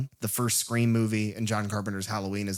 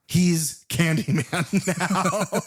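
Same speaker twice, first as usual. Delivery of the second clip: laughing; 6 semitones higher, 4 dB louder, much slower than usual. Real, not acted. Joke punchline laughing.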